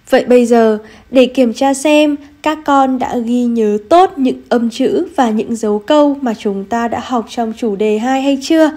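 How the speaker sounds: ordinary talk, nothing marked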